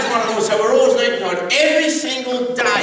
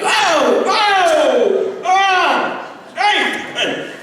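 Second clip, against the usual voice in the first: pained cry; 11 semitones higher, 3 dB louder, much slower than usual. Retold or imitated. Father cries out in pain.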